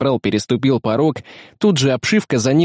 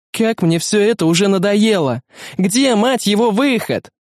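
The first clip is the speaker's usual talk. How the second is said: exasperated shout; 7 semitones higher than usual. Acted. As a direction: vent furiously at the endless maze. Sergeant fed up in fear room.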